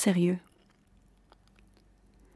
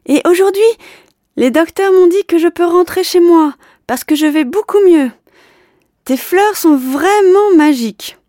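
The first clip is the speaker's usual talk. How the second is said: high, loud happy yell; joyful shout; 9 semitones higher, 7 dB louder than usual. Acted. Boy still shouting from window.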